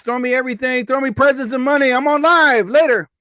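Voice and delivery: hammed-up TikTok Live intro; hyped live host. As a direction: sell it like a livestream host begging for gifts. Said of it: "Why am I talking like this?".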